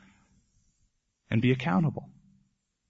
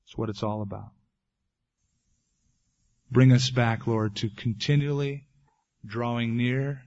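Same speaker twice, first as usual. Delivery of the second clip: prayer. Acted. Closing prayer.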